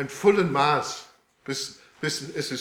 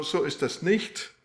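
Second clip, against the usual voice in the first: usual speaking voice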